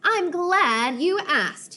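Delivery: high, slow, overly sweet; fake-smile politeness; fake cheerful. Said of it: "huge fake smile".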